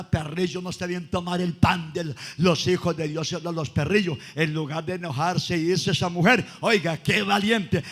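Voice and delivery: lively storytelling preacher; preaching. Acted. Preacher telling the congregation about the Canaanite woman, preaching. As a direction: voice the disciples and Jesus with fire.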